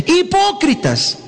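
high, slow rebuke of Hipócritas; Jesus rebuking the crowd, high and slow; scornful rebuke. Acted. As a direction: cry out Hipócritas as an accusation. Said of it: "Decía también a la multitud".

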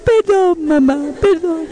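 put-on high, slow pleading child's voice; crying little boy. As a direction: beg mom's forgiveness like a weepy toddler. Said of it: "viene el chiquitito llorando".

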